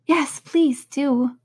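slow, pleading plea. Acted. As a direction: beg them gently, slowly. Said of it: "pleaded Alice".

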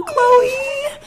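high shrieking call of her name; scandalized shriek at a friend; shocked shout. Real, not acted. Shocked by friend's pickup line.

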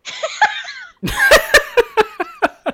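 high, helpless cackle; long squealing laugh; real laughing fit. Real, not acted. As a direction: crack up at your own punchline. Cracks up at her own punchline.

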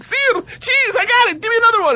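very high, excited squeal; eager. Retold or imitated. Student showing off his Russian to gangster, eager. Eagerly answers language challenge.

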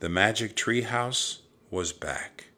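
plain reading voice

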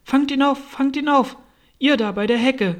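high, loud urgent calling; rabbit's shouted orders. Acted. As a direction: shout orders across the garden. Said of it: "die Stimme des Kaninchens allein".

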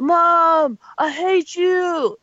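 high, loud, dragged-out mock tantrum; bratty child outburst. Grown child yelling at mom in mock tantrum. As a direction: wail it like a sulky kid at mom. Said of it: "you're like".

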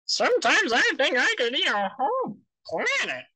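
very high, wobbling cartoon voice; high, lilting character impression; Jimmy. Shown as a demonstration. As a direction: pitch it way up, swooping on each word. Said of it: "it sounds like Jimmy".

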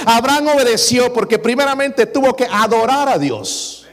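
voz de púlpito, alta y enfática; predicando con fuerza. Acted. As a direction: proclama cada frase a la congregación. Preaches at full force. (predicador exhortando a los hermanos con fuerza)

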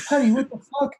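loud, high-pitched imitation of disbelief; imitated shocked yell; mock startled outburst. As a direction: blurt it out way up high. Brother's startled outburst, retold.

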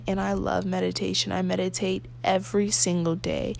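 usual speaking voice

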